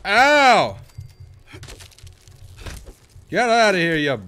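angry yelling. Real, not acted. Still fighting off the ticks.

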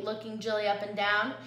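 ordinary delivery

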